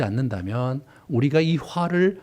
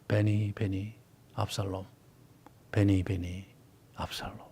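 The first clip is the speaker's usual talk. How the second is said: soft, low, fading Hebrew lament; weeping lament; 6 semitones lower, 11 dB quieter than usual. Shown as a demonstration. David crying for his son, soft fading weeping. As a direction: let the name fade into weeping. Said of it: "이러면 우는 거예요".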